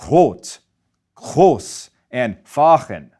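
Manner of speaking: "rot", "groß", "fahren" with the R low in the back of the throat; throaty back R. Shown as a demonstration. Teacher modelling the northern German R for students, throaty. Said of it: "pronounce it low and in the back of their throat".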